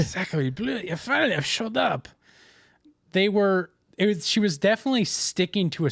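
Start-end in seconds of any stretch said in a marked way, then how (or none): 0.0-2.1 put-on higher-pitched joking French accent; mock French accent. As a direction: play the saleswoman as a cartoon French. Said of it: "very thick French accent".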